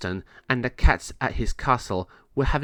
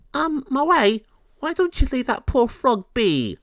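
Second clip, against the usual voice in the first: high, louder gentle scolding; scolding cat voice; 14 semitones higher, 4 dB louder than usual. Acted. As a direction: chide him gently, high. Dory scolds Moe.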